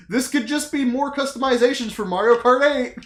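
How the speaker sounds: high, loud laughing skeptic; laughing at his own doubt. Retold or imitated. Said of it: "I was like".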